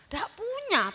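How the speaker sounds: usual speaking voice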